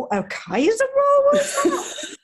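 high-pitched, incredulous questioning; baffled exclamation. Retold or imitated. British waitress baffled by American deli rolls, high-pitched bewilderment. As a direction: squeal in comic bewilderment.

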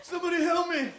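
high, hammed-up wail over a hand; mock despair. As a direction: play it like a tragedy.

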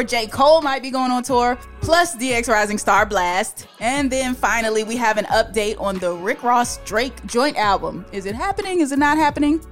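ordinary delivery

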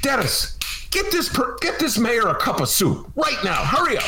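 bossy restaurant owner. Retold or imitated. Restaurateur ordering staff for mayor.

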